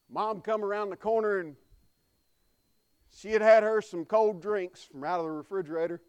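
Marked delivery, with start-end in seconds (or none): none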